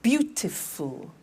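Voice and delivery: Beautiful said slowly, syllable by syllable; BEAU-ti-ful, drawn out; slow syllable demo. Shown as a demonstration. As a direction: stretch out Beautiful for counting. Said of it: "Three syllables".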